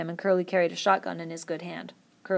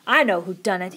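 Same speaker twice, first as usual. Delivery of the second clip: louder, accusing outburst; crying out; 6 dB louder than usual. A narrator's reading. Curly accusing Lenny in front of the men, loud. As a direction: call it out to the men. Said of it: "he cried".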